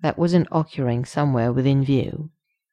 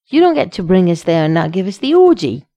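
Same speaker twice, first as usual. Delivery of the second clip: loud, higher scolding protest; indignant exclamation; 4 semitones higher, 8 dB louder than usual. A narrator's reading. Shallot scolds Sheske for withholding the scene, loud indignation. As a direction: protest like a cheated fan. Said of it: "Three exclamation marks".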